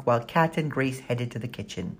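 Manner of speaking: ordinary delivery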